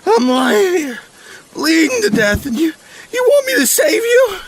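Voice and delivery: very high, loud, near-crying rant; agonized indignation. Acted. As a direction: rail through agony, breaking into cries of pain. Bleeding, indignant, in agony.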